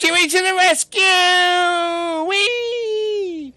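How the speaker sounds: very high, slow, loud battle whoop; high gleeful cry of a character leaping in; heroic charge cry. Acted. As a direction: yell it while flying into the fight.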